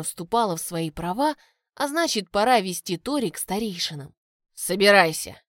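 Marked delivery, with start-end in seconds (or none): 4.5-5.4 louder, clipped command; curt order. A narrator's reading. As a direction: bark the order curtly. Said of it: "отрывисто бросил он девушке".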